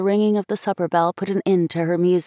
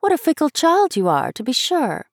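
much higher, amused reproach; chiding schoolgirl; 8 semitones higher than usual. A narrator's reading. Schoolmate scolding a fickle child, lightly chiding. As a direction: tease her for being fickle.

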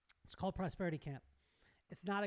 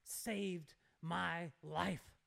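serious repetition; 5 semitones lower, 4 dB quieter, much slower than usual. Retold or imitated. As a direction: imitate her very slow, solemn insistence. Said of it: "then she says it again".

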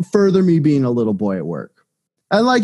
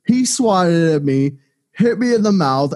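ordinary talk, nothing marked

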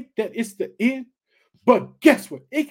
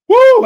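loud high joyful woo; excited whoop; 17 dB louder than usual. Real, not acted. Preacher whooping with excitement over the scripture. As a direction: let out a thrilled shout. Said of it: "I just got so excited".

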